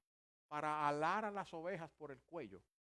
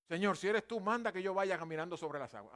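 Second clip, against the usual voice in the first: loud, brash challenge; big-mouthed bravado; 3 semitones higher, 9 dB louder than usual. Acted. Big-mouthed Pedro to the Lord from the boat, brash bravado. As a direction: blurt it out boldly, loudly. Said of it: "de bocón".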